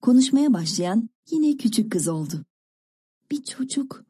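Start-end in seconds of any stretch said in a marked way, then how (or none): 3.3-4.0 hushed, voiceless disbelief; barely voiced, awed murmur; stunned whisper. A narrator's reading. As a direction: whisper it to yourself in amazement. Stunned girl repeats it again.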